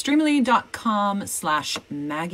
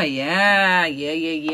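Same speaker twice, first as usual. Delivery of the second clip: usual speaking voice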